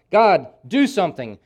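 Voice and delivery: loud, high demand that God act; outcry to God. Acted. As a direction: call out to God in anguish. Preacher voices Habakkuk's outcry.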